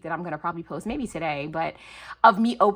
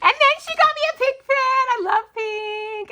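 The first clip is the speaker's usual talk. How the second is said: excited squeal; 6 dB louder than usual. Real, not acted. Gushing over pink pen gift.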